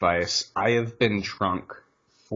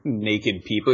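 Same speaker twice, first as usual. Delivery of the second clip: usual speaking voice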